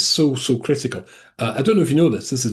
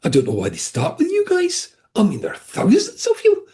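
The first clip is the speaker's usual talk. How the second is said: put-on very high, lilting northeast England accent. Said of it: "northeast of England accent".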